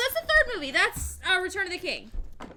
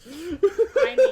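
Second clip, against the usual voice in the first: laughing; 5 semitones higher, 13 dB louder than usual. Real, not acted. Mock-solemn acceptance of soundboard role.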